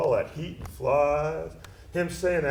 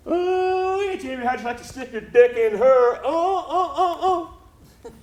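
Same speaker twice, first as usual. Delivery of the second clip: high, leering tease with laughing moans; jeering mock ecstasy; lewd mocking; 13 semitones higher, 3 dB louder than usual. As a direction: hoot crudely way up in pitch. Mimics crude teasing about Sarge.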